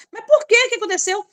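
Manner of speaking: surprised therapist. Retold or imitated. Therapist surprised at husband's story.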